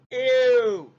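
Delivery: loud, high mock disgust; kid's disgusted ew. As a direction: shriek ew like a grossed-out kid. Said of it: "The kid was like".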